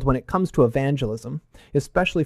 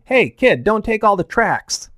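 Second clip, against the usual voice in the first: imitated scolding, higher and louder; scolding pastor; 6 semitones higher, 4 dB louder than usual. Pastor to a kid taking all the tracts, mock scolding. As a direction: tell a kid off like an exasperated pastor. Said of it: "I remember my pastor scolding me".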